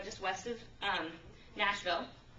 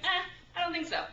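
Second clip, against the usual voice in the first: dismissive refusal; 7 semitones higher than usual. Retold or imitated. Jonah refusing God's call.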